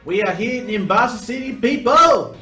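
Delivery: loud, high hype shout; hyped announcer. Acted. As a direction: announce it to the crowd. Hyped city arrival announcement.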